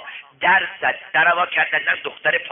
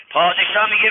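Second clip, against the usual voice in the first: ordinary talk, nothing marked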